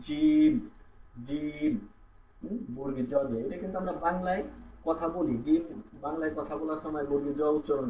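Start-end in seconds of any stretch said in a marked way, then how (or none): 0.1-1.7 জিম with mid-tongue pressed to the palate; drawn-out jim. Shown as a demonstration. Teacher models জিম for learners, mid-tongue on palate. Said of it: "মাঝখানেরটা আমাদের উপরের তালুর সাথে মিলিয়ে".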